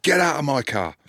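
high-pitched angry order, imitated; raging driver. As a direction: throw out a passenger in mock fury. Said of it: "like that".

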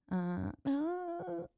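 high slow squeaky hello; parrot hello. Shown as a demonstration. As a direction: stretch it out like a parrot. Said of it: "Do it again?".

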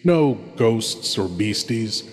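ordinary talk

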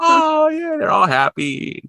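high, slow gushing delight; excited. Retold or imitated. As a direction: gush with high-pitched joy. Voicing happy reunion.

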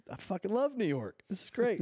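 high, giddy exclamation; gleeful high-pitched storyteller's quote; retold elation. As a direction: act out being thrilled by the attention.

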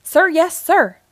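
loud, high military reply; forceful parade-ground answer; army sergeant. A narrator's reading. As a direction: shout it like a soldier reporting. Toy soldier Sarge answers his leader.

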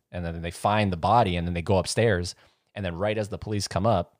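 ordinary talk, nothing marked

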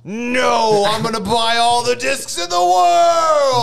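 loud, slow whiny yell; tantrum shout. Acted. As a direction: scream it like a spoiled kid. Tantrum over discs in skit.

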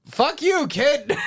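high mock Yiddish accent; brash Yiddish-accented jab. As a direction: ham up an old Yiddish grouch. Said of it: "How would you do it in your dialectical Yiddish".

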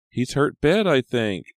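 high, loud alarm; distressed. A narrator's reading. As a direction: say it with worried urgency. Maya worries over bleeding teacher.

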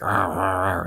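imitated vampire growls; gang growling. Vampire gang menacing the Slayer, mock growling. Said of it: "then the gang starts".

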